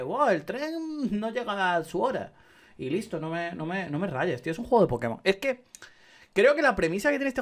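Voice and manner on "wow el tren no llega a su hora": slow, higher, sarcastic wonder; mock-dramatic about a late train; mocking the NPC. Mocking a game NPC, slow and higher. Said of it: "o sea, dime".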